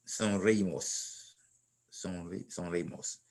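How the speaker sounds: sonreímos drawn out slowly and softly, syllable by syllable; slow, careful teacher demo of sonreímos; slow model. Shown as a demonstration. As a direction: spell out sonreímos slowly for the student. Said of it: "teacher models the nosotros form".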